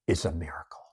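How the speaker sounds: hushed awed whisper. Real, not acted. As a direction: drop to a breathy whisper of awe. Preacher whispers for effect.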